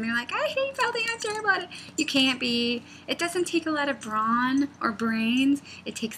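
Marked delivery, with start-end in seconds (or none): 0.3-1.7 high-pitched mock wail; exaggerated high complaint; mock scared beginner. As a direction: imitate a panicky beginner, pitched way up. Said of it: "people get really scared when they start talking".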